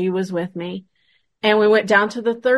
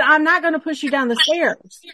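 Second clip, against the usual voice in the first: raised, higher-pitched mock exasperation; retold exasperated protest; 8 semitones higher, 3 dB louder than usual. Friend reassuring a frightened girl on the stairs, retold exasperated protest. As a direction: act out protesting an accusation, pitch jumping up.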